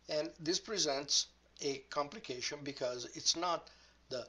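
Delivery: ordinary delivery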